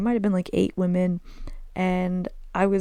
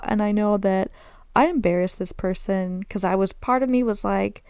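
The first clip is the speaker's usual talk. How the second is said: ordinary talk, nothing marked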